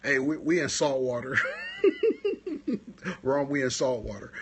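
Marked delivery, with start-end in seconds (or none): 1.4-3.2 real squealing laugh; high burst of laughter. Real, not acted. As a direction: crack up high. Laughs again.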